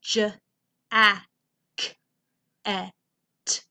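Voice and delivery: jacket sounded out as j, a, k, e, t; phonics-style j... a... k... e... t; individual sounds. Shown as a demonstration. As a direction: isolate each of the five sounds of jacket with pauses. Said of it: "It's not the s-, individual sounds".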